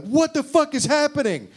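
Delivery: high, loud freaked-out cry; mock panic. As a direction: sound comically terrified. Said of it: "We love to be like".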